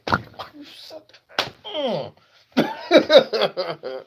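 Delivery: high, slow, fed-up voice; exasperated mock complaint. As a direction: trail off in disgust. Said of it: "You be like".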